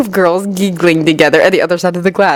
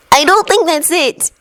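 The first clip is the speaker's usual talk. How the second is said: brave disagreement; 11 semitones higher, slower than usual. A narrator's reading. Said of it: "bravely opposing Luna".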